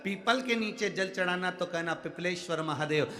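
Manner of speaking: preaching at full force. Acted. Preacher rallying devotees barred from the temple, fervent and loud. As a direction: rouse the whole crowd behind Mahadev.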